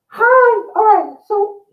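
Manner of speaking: Val's voice. Acted. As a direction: play the perky talking doll. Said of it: "and then I'll just do my voice".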